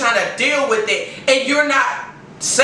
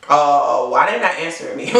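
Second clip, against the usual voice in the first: mock confused partner; 9 semitones lower than usual. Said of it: "get that person thinking".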